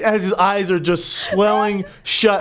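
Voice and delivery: ordinary talk, nothing marked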